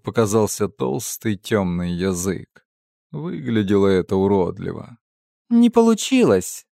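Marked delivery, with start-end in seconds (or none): 5.5-6.7 put-on sighing girl voice. A narrator's reading. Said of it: "вздохнула Ица".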